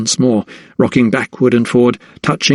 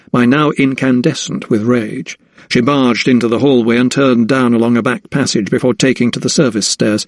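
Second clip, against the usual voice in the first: ordinary delivery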